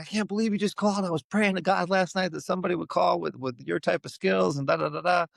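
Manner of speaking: high, fast, overexcited rush of words; gushing friend. Retold or imitated. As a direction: play the friend who can't believe his luck. Friend gushing over the call.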